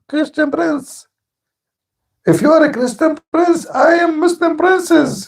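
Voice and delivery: mock high, slow, hammed-up squeaky voice. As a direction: sneer it out as a preening opponent. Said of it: "he called himself Princess".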